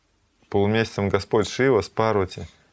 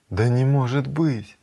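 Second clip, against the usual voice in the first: higher, slow, drawn-out exclamation of disbelief; astonished disbelief; 5 semitones higher, 3 dB louder, slower than usual. Acted. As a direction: stretch it out in acted amazement. Grandmother hears unbelievable whispered news.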